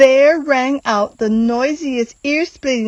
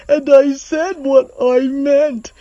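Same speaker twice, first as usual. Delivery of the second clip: slow, queasy, seasick delivery; slow seasick elephant moan; seasick Horton; 4 semitones higher, 4 dB louder than usual. Acted. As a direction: drag it out like you're about to be sick. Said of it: "I am seasick one hundred percent".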